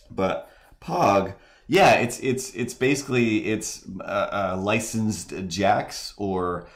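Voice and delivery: ordinary talk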